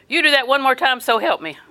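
loud, high hollered warning; scolding parent's threat. Acted. Parent threatening misbehaving children.

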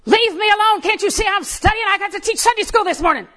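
put-on high, loud snapping at the kids; irritated shout. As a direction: bark it at the children who keep interrupting. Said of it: "Just like that. That's how I said it".

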